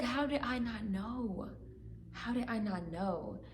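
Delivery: soft, slow, choked repeated question; holding back tears. Real, not acted. Trying not to cry on camera, she quietly repeats her question. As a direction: fight the tears as you say it. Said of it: "I'm trying to laugh 'cause I don't wanna cry".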